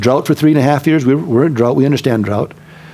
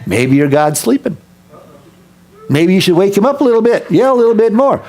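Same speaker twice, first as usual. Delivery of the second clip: mocking taunt climbing high; 7 semitones higher than usual. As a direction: needle them with fake helpful advice. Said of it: "He starts taunting them".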